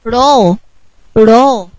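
long drawn-out "را" with an open a; letter sound demo. Shown as a demonstration. Teacher showing children the fatha sound, "را" held long. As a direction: say "را" as a held "raa" syllable. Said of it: "طب الحرف هينطق إيه؟".

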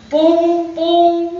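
playful sung door knocks; knocking sound. Acted.

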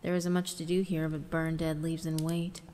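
ordinary delivery, nothing marked

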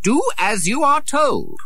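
high, slow, haughty order; stern command. A narrator's reading. Prince ordering a laughing boy, slow and stern. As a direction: spell it out to a servant. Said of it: "Edward said".

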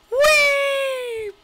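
very high thrilled whee; gleeful squeal. Real, not acted. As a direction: squeal with delight on the fall. Riding the drop.

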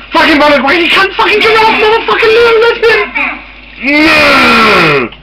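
high screaming rage at the game; furious shout. Real, not acted. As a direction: scream it out in real fury.

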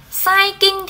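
"Sai kinh" lifted high to flag it as wrong; "Sai kinh" said high, teacher marking an error; quoting a mistake. Shown as a demonstration. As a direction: hold up "Sai kinh" with a high questioning pitch. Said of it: "thì chưa ok lắm nhá".

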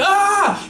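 short, very high yell; comic scream as the race starts; mock battle scream. Racing cell screams after gun.

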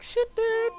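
high-pitched mimicked sound effect; quick high action sound; imitated shot noise. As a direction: squeak out the first shot's noise. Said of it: "At first I like".

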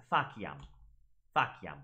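faciam with a K sound, FA-ki-am; hard C. Shown as a demonstration. Teacher correcting the students' faciam with a hard K. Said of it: "They're both wrong".

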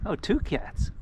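normal talking voice

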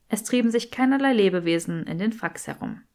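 usual speaking voice

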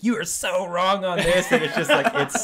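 high, loud, laughing through the words. Retold or imitated. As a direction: read it out while cracking up. Friend's teasing message quoted.

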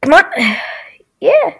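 ordinary delivery

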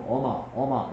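put-on 어마 for 엄마 in an English accent; English accent Korean. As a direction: foreigner saying 어마 with one m. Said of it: "그러면 뭐라 그러냐".

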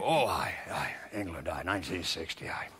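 sleepy low mumble of Ron; hint of Ron half asleep; imitating Ron. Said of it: "He go".

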